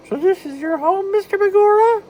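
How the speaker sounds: high, loud falsetto mouse; a bright cartoon mouse asking; Mickey Mouse voice. Mickey greets Patrick.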